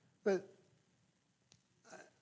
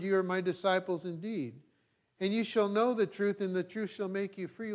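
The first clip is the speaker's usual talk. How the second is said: raised, declaiming Bible reading; scripture reading; 4 semitones higher than usual. Acted. Pastor reading John aloud to the church, declaiming. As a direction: proclaim the text to the congregation.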